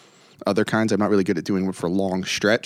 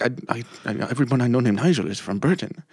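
put-on Indian accent; 2 semitones higher than usual. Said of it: "there was this Indian guy".

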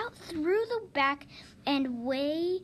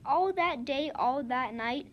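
ordinary delivery, nothing marked

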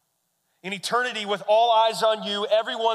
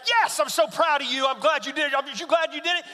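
high, louder praise; proud cheering; 9 semitones higher, 4 dB louder than usual. Retold or imitated. Proud dad cheering his daughter after the jump, high and loud. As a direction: cheer her on.

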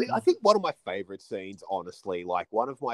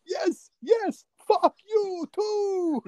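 much higher, slow joyful reply; imitating cheerful Akeem; 5 dB louder, much slower than usual. Akeem cheerfully answering the insult, beaming. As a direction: beam it back innocently.

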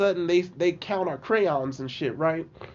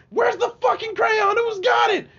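put-on angry kids yelling. Angry teens demanding the missing crayon back, yelling. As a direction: shout it like an angry teen.

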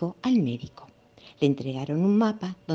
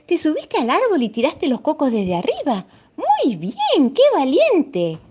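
high, loud proud praise; praising the monkey; 11 semitones higher, 5 dB louder than usual. Acted. Hippo praising the monkey, high and loud. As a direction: cheer the monkey's courage.

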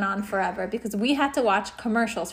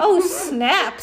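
laughing tease; 7 semitones higher, 5 dB louder than usual. Acted. Ryan teasing, laughing.

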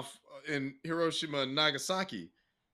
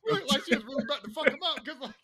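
laughing; 9 semitones higher, much faster than usual. Real, not acted. Laughing, excited retelling.

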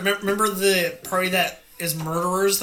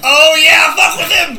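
high, loud, gleeful egging-on; excited shout; 8 semitones higher, 9 dB louder, slower than usual. Real, not acted. Player cheering on the attempt on the captive, excited shout. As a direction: burst out loudly, urging them on. Player eagerly urges the mind trick.

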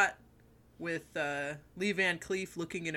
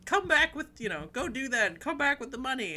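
higher, pushy mother voice; shrill-free nag, pitched up; nagging mother; 6 semitones higher than usual. Retold or imitated. Retelling the film, the mother sending her son off for money. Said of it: "his mom is all like".

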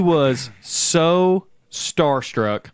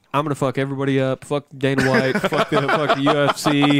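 rapid mock trash talk. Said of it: "It was like".

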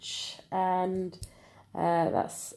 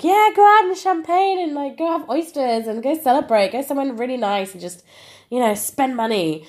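excited friends; 6 semitones higher, 4 dB louder than usual. Retold or imitated. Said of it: "everybody was like".

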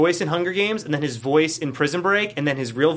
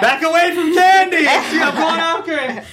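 high, loud playful threat; mock tough guy; 11 semitones higher, 5 dB louder than usual.

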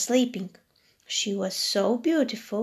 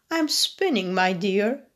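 put-on old woman voice; 3 dB louder than usual. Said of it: "said the woman".

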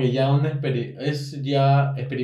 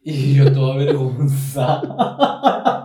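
slow and louder through real laughter; dragging it out while laughing; laughing embarrassed; 7 dB louder, much slower than usual. Real, not acted. (avergonzada entre risas, despacio)

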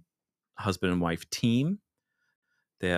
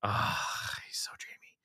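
swooning whisper; 8 dB quieter than usual. Real, not acted. Swooning over Patrick Wilson.

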